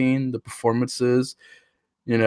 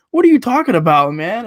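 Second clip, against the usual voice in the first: higher, louder "what are you talking about"; baffled disbelief; 7 semitones higher, 5 dB louder than usual. Retold or imitated. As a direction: act out your own stunned reaction, louder. Reacting to the boyfriend's line.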